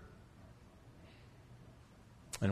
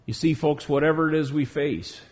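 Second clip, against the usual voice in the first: ordinary talk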